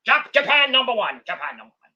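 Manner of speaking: mock wrestler yelling; Mike Awesome impression. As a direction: ham up a wrestler's promo. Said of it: "my Mike Awesome impression".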